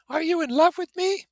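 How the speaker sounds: coy, teasing socialite question; Daisy's voice. Acted. Daisy teasing her cousin in his ear, very high. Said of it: "she said low in my ear".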